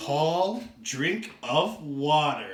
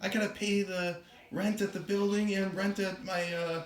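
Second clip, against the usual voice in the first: soft, slightly raised worried voice; lightly voiced bookseller; 3 semitones higher, 5 dB quieter than usual. Bookseller fretting to her cat about rent, soft and lightly acted. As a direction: fret quietly to the cat.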